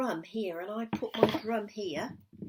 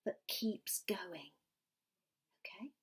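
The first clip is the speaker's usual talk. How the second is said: quick hushed whisper; 2 semitones lower, 8 dB quieter than usual. Real, not acted. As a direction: whisper the instruction gently. Whispered instructions.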